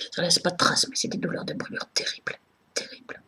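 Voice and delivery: real hushed, strained voice, fading away; pained near-whisper. Real, not acted. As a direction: let the pain sink into a whisper. Speaker describes own terrible burning pain.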